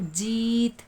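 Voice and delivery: जीत with a long, held 'ee'; long drawn vowel. Shown as a demonstration. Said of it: "खूब खींचकर पढ़ी जाती है". (जीत की ई खूब खींचकर)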